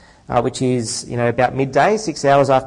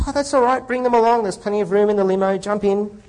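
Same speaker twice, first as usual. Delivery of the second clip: high, breezy lawyer; hearty host, much higher and quick; 6 semitones higher than usual. Acted. Lawyer voiced in joke.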